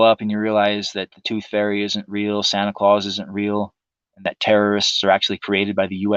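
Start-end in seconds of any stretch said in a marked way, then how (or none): none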